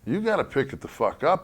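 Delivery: high, loud chewing-out; angry scolding. Retold or imitated. The other faction scolding the lazy workers, angry. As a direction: scold like a drill sergeant. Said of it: "the other fucking faction saying".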